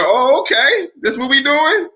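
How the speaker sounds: high, laughing oh okay; amused challenge. Retold or imitated. Told his homie uses his name, he answers with high amused surprise. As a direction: toss back a playful dare. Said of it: "So I said".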